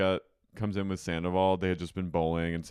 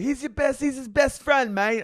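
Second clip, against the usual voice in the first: high, loud exclamation; mock indignant protest; 6 dB louder than usual. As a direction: plead the point in loud protest. Acting Brock's protest, retold.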